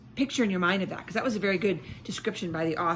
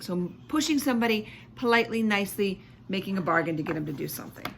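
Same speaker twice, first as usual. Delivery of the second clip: ordinary delivery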